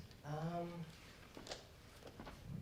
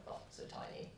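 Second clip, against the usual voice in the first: whispering; 16 dB quieter, faster than usual. Acted. Whispered aside.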